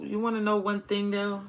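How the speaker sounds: normal talking voice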